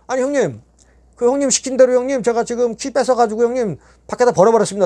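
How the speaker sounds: 높은 목소리로 신나서 전하기; 해냈다고 자랑하듯; 들떠서 보고하기. Retold or imitated. 형님에게 한 일 자랑. (형님에게 높고 들뜬 목소리로 보고)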